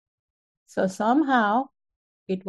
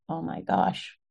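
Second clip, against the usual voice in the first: whispered in disbelief; breathless whisper; 2 semitones lower, 5 dB quieter than usual. Real, not acted. Recalling mom's discovery, a stunned whisper. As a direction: let it fall away into a hushed whisper. Shocked at what mom found.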